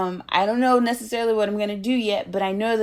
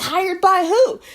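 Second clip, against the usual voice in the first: much higher and louder squeal of a question; delighted surprise; 12 semitones higher, 7 dB louder, slower than usual. Retold or imitated. Speaker reacting to Larry's hiring news, high thrilled disbelief.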